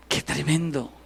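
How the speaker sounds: normal talking voice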